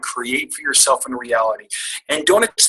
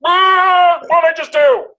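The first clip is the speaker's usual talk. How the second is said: panic; 7 dB louder, slower than usual. Retold or imitated. Panic after blurting invite.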